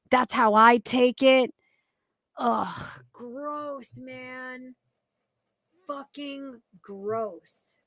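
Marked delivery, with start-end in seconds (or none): 2.4-7.8 disgusted groan. Real, not acted. Reacting to his claims with muttered, much softer disgust. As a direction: let the disgust sink, almost to yourself.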